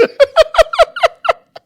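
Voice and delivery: high, loud burst of laughter; loud high laughing burst. Real, not acted. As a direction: crack up loudly.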